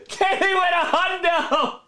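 excited call cracking into giggles; can't stop laughing. Real, not acted. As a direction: call it out while helplessly laughing. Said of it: "I can't stop laughing".

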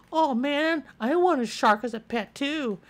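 put-on high whiny kid voice wanting a shark too; jealous classmates. Classmates begging for a shark of their own, high whiny envy. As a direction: whine like an envious classmate. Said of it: "They're gonna be like".

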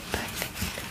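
ordinary delivery

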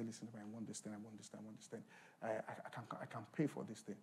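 quiet, pleading buyer voice; hushed eager buyer. Acted. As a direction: murmur it like you're bargaining for the TV. Preacher acts out buyer in shop.

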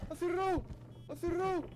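high, panicked squeal; being chased. Real, not acted. Door shut during the hunt.

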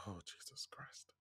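slow, voiceless breath of dismay; appalled whisper. Real, not acted. Fan recalling the firing lines, appalled whisper. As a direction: whisper it slowly, under your breath.

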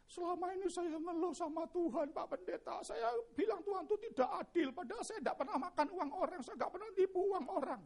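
high, pleading imitated wail; tearful lament. Wheelchair user complaining to the pastor about God, lamenting. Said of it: "dia meratap".

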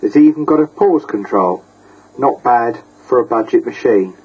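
normal talking voice

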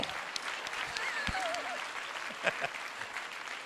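breathless high-pitched laugh; real laughter after a joke. Real, not acted. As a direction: laugh at your own joke, airy and high. Laughs after his own joke.

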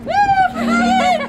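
high excited cheer. Real, not acted. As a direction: call out her name with glee. Cheering as Helen is introduced.

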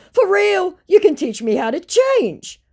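high, pleading mock voice of the scammer. Reading the scammer's message aloud in a high mock voice.

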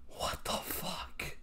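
slow, unvoiced what the fuck; shocked whisper. Real, not acted. Reeling from the death, a shocked whisper. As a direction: barely breathe it out in disbelief.

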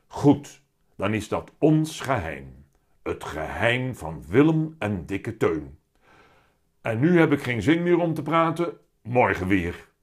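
loud, weighty cat voice; heavy-voiced talking cat. A narrator's reading. The cat explains himself to Willem, loud heavy voice. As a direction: pronounce it grandly as a big cat. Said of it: "met zware stem".

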